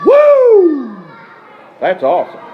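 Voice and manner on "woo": loud high "Woo!" whoop; wrestling whoop. Real, not acted. Wrestler whooping to the interviewer, loud high woo. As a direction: let out a wild Ric Flair woo.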